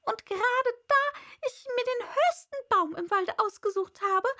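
high, loud bird tirade; agitated pigeon ranting. A narrator's reading. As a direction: raise the voice in outraged complaint. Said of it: "mit erhobener Stimme".

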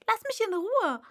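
high, loud indignant protest; affronted demand to be left alone. A narrator's reading. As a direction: snap it out, offended. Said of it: "sagte Alice mit Entrüstung".

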